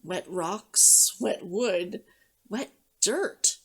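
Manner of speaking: ordinary delivery, nothing marked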